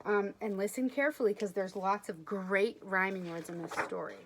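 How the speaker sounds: usual speaking voice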